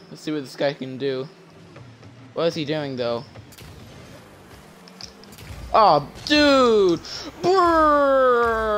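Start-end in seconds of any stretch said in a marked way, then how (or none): 5.7-8.8 loud high drawn-out bruh; excited shout. Real, not acted. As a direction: yell at the play in disbelief. Reacting to a play.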